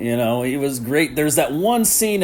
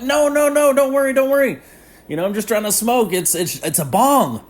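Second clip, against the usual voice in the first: frantic stoner pleading; 11 semitones higher than usual. Retold or imitated. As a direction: rush to calm everyone down in a high voice. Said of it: "and he's like".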